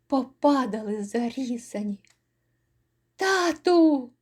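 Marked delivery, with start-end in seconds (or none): 3.2-4.1 put-on high small child's cry of Тату; a child calling out to its father; frightened child's cry; babbling child. A narrator's reading. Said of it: "белькотали".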